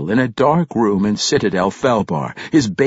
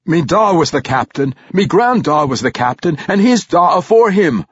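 high, hearty dwarf boast; proud dwarf father, raised voice; 7 semitones higher, 4 dB louder than usual. A narrator's reading. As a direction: list the family line with pride. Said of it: "Reginald said proudly".

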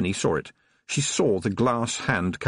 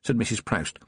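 usual speaking voice

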